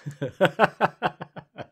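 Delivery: high run of chuckles; laugh. Real, not acted. Friend amused by the famous family, laughing.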